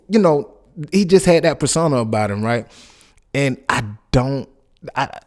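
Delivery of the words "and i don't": ordinary talk, nothing marked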